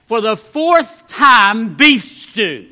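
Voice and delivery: wife snapping back slowly and loudly; exasperated. A narrator's reading. Wife answering the husband's fourth ask, loud and exasperated. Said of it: "to his surprise and chagrin".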